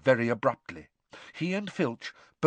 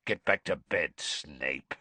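menacing order; 8 semitones lower than usual. A narrator's reading. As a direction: dismiss a rival in a low voice. Moody menacingly orders Snape away.

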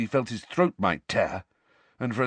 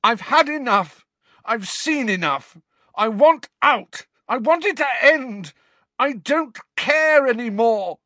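raging outburst; 13 semitones higher, 5 dB louder than usual. A narrator's reading. Harry raging, smashing things.

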